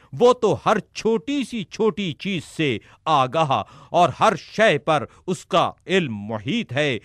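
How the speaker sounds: ordinary talk, nothing marked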